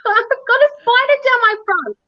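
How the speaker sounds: urgent, rising panic; panicked plea. Retold or imitated. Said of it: "I said".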